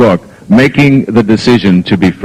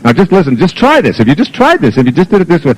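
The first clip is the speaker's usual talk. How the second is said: higher-pitched, pestering kid imitation; fast-talking daughter; 5 semitones higher than usual. Daughter telling dad to just try it, fast-talking imitation. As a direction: run the pleas together, pitched up like an insistent child. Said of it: "She talks really fast like this and goes on and on".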